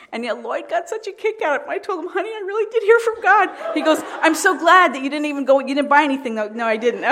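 bubbling over with laughter as she tells it; laughing. Real, not acted. Telling the church how her husband teased her, laughing.

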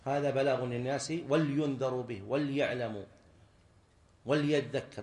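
ولينذروا, وليعلموا, وليذكر each misread with "wal"; mistaken silent lam. Retold or imitated. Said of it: "بعض الناس يقراها".

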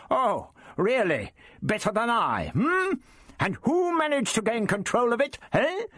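put-on very high, indignant old man; thunderous outrage. A narrator's reading. The Doctor rounds on rival Prapillus, outraged. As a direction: draw yourself up, affronted, pitch soaring. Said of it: "drew himself up in thunderous outrage".

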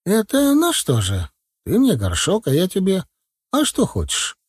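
put-on high, chattering old granny voice; old village woman. A narrator's reading. Старушка в сером платке жалуется на деда, высокий старческий голос. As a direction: tell it like a fussy village grandma.

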